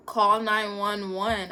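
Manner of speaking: loud, slow, insistent order; urgent demand. Retold or imitated. Student insists to the teacher, loud and slow. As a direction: hammer home each word, desperate. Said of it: "yelling at everybody".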